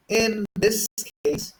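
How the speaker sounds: ordinary delivery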